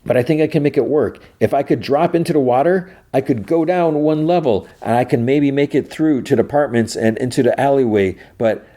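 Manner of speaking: urgent calling, not fully shouted. Retold or imitated. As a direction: pitch it up to carry to someone shut away. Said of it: "She's calling Solo".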